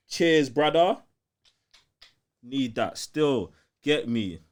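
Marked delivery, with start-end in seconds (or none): none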